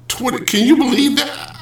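high-pitched, astonished disbelief; incredulous. Real, not acted. Host astounded by his own math, incredulous.

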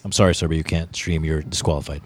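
low-pitched mock official refusal; imitated tournament official. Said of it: "That's what they're gonna say".

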